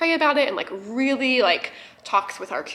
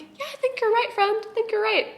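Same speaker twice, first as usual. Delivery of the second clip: much higher, choked-up little voice; high teary agreement; 12 semitones higher than usual. Retold or imitated. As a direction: squeak it out through tears.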